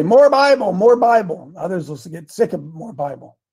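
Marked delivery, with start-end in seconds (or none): 0.0-1.5 raised, louder mimicked demand; mock demanding. Listener demanding more scripture from the show, mock insistent.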